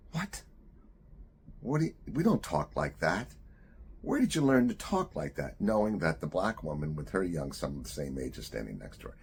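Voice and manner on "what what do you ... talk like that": shocked mom, high and loud; indignant mother telling off her son; scolding mother. Retold or imitated. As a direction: scold a child in a raised, high voice. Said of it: "the mother looks at her son and says".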